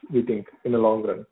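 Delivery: ordinary talk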